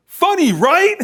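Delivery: ordinary talk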